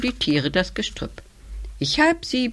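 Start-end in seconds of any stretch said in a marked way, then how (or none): 1.8-2.5 squeaky. A narrator's reading. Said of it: "piepst plötzlich die Maus".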